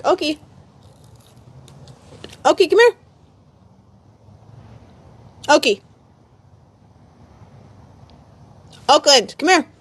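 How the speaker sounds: loud, high call across the yard; calling the dog. Real, not acted. Streamer calling her dog Oki to come, loud high call.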